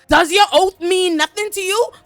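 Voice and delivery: shouted high and loud, outraged; furious royal shout. Retold or imitated. King shouting at Sir Kristen Cole, outraged. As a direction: bellow it at the disloyal knight. Angry king confronts knight.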